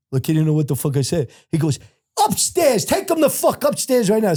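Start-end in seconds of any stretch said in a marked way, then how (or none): 2.3-4.3 imitated high-pitched outburst; retold furious order. Officer ordering a prisoner upstairs, retold furious command. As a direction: send him away in a high, angry voice.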